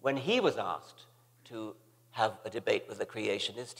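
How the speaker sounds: ordinary talk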